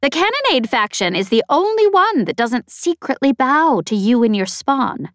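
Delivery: high, loud, boastful snappish alien. A narrator's reading. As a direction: brag about your champion, snapping. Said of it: "snappish and vaguely familiar".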